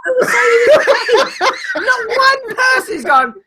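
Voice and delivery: high, loud, slow shout through laughter; laughing shout. Real, not acted. Co-host echoing the protest, laughing high shout. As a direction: shriek it while cracking up.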